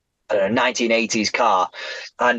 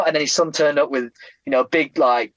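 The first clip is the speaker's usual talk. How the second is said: normal talking voice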